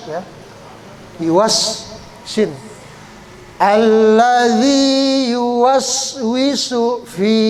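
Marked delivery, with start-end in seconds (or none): 3.6-7.4 zal as th sound. Shown as a demonstration. Teacher modelling the zal sound, slow chant. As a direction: bite the tongue tip for the z in "Allazi".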